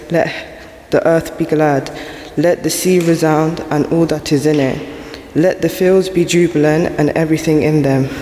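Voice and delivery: normal talking voice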